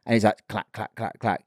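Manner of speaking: softly voiced clack, clack of a coaster chain, trailing away; describing the bridge to listeners, imitating a coaster's clacks; roller coaster clacking. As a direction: mimic the coaster climbing the lift hill. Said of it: "like when you're going up a roller coaster".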